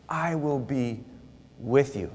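soft, slow echo of the promise; quiet reassurance. Acted. Preacher echoes God's promise to Moses, soft and gentle. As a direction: repeat it gently to yourself. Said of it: "the Lord is so kind".

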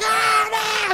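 high, loud mock scream of panic; panicked screaming. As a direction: shriek it like a crowd losing it in the dark. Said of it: "people are just screaming".

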